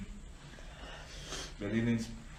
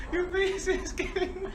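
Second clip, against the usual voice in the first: laughing. Real, not acted. Laughing at friend's face.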